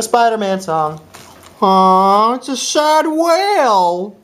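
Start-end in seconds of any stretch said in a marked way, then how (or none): 1.6-4.2 high, slow put-on whale moan; sad whale voice. Voicing a sad whale for friends, high moan.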